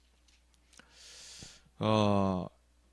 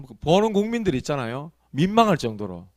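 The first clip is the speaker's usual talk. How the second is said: ordinary talk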